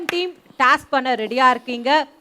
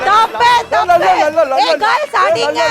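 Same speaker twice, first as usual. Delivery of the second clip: shouting; 2 semitones higher, 5 dB louder than usual. Real, not acted. Urging girls to hit.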